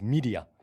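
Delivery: "media" with a long ee, MEE-dia; American pronunciation. Shown as a demonstration. Correcting the other speaker on the English word, American pronunciation. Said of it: "je pense qu'ils disent".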